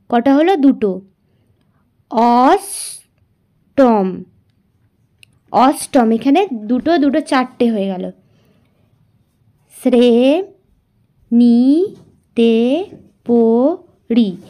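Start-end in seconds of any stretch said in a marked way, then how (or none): 2.1-4.2 অষ্টম stretched very slowly into its syllables. Shown as a demonstration. Teacher dividing অষ্টম into syllables, very slow. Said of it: "তাহলে এখানে আমরা করি". 9.8-14.4 শ্রেণীতে পড়ি drawn out very slowly; syllable by syllable. Shown as a demonstration. Teacher slowly splitting the words for students. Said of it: "তাহলে এখানে আমরা করি".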